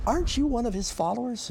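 high, pointed question; accusing servant. Acted. Servants accuse Peter by fire.

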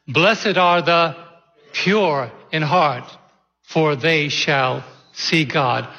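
"pure" leaned on, whole verse slow; emphasis on pure. Shown as a demonstration. Pastor leading the congregation in reading, stress on pure. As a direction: read it together, pressing on "pure". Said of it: "give some special emphasis to it".

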